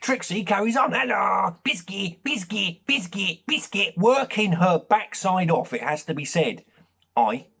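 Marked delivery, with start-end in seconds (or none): 0.9-4.0 higher eager chant of biscuit; eager begging dog. Retold or imitated. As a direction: yap for a treat, over and over.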